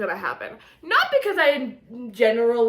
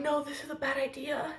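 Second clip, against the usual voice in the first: hushed quote; 2 semitones higher, 12 dB quieter than usual. Retold or imitated. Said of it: "they were like".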